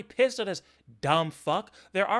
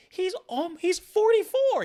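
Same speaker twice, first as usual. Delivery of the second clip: much higher, louder cry of disbelief on "forty-four"; incredulous outburst; 13 semitones higher, 6 dB louder than usual. Real, not acted. Disbelief at someone's age.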